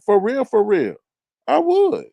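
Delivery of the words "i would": a high, emphatic I would; squeaky high I would. Real, not acted. To chat, squeaking I would high.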